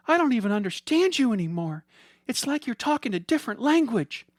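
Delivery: high, fast, tearful pleading; a wife near tears, hurt and confused. Acted. Heidi close to tears, pleading with Billy. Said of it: "Tears welled in Heidi's eyes".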